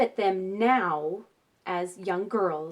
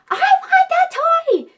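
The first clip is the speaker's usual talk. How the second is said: put-on whiny toddler demand; 13 dB louder, slower than usual. Toddler demanding before rephrasing.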